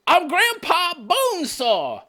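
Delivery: high, drawn-out mimicking cry; Grandpa Bonesaw. Reactor imitating the attacker announcing himself, mock yell. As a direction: stretch it out in a hammed-up high voice. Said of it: "He's Grandpa Bonesaw. Did you hear that?".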